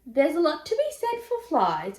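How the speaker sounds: high, eager dingo. A narrator's reading. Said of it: "agreed Bingo".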